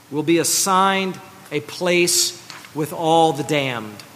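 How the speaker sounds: slow, grave sermon delivery; measured, weighty pulpit warning; solemn preaching. Acted. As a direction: warn the congregation slowly and gravely.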